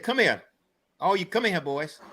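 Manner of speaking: high-pitched call to come over, dropping at the end; grandfather calling boys. Retold or imitated. Grandfather summoning the boys from the garage, calling out.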